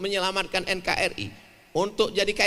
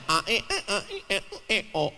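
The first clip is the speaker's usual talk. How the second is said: short Vietnamese-style tonal vowels; mock Vietnamese alphabet. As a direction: imitate a Vietnamese alphabet lesson. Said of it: "abjad Vietnam". (menirukan abjad Vietnam dengan vokal pendek bernada)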